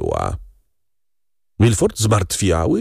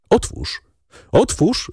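insistent command through a door; 10 semitones higher than usual. A narrator's reading. Husband orders his wife to open up, commanding. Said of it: "w głosie jego dźwięczał rozkaz".

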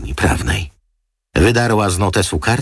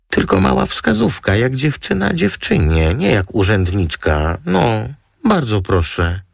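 much higher, pleading sweetly; wheedling model; 9 semitones higher than usual. Acted. Model pleading girl to girl for a favour, high and sweet. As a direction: beg her like a pouty girlfriend.

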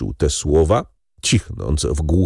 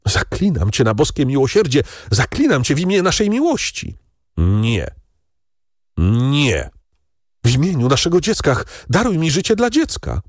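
higher, rising desperate plea; begging for life; 7 semitones higher than usual. Acted. Wife pleading with prosecutor husband for her life.

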